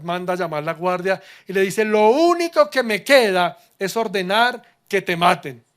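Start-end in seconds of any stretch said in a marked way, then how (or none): 1.9-5.6 loud, furious royal threat; king ordering the execution, raging; enraged king. Acted. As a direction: roar the death sentence. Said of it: "El rey ya iracundo".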